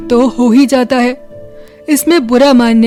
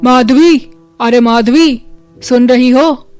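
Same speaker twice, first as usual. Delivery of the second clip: mildly raised farmer's call; 4 dB louder than usual. Acted. Farmer calls his wife early in the morning, calling out. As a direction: call out for your wife, a little loud. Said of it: "क्यों चिल्ला रहे हो".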